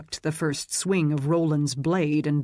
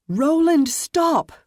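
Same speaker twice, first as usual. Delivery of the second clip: frantic shout, far higher and louder; calling out; 12 semitones higher, 7 dB louder, slower than usual. A narrator's reading. Sarah stopping her husband's fight, frantic shout. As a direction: scream at him to stop. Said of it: "Sarah called".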